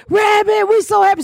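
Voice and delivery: high, excited fan squeal; mock gushing. Crackheads grabbing Rabbit in delight, mock gushing. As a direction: gush over her like thrilled old friends.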